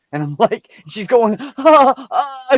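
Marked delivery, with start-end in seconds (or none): 1.4-2.6 high, loud mock wail of pain; imitated crying. Retelling Tanya's cries with a lizard on her finger, high mock wail. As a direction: cry out like the bite hurts. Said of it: "she's crying".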